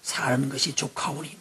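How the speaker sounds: slow, soft, sighing "사는 것이 족하오니"; weary Elijah. Retold or imitated. Elijah telling God he has had enough, slow and soft. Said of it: "한다는 소리가 뭐였어요?".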